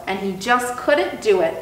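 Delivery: plain reading voice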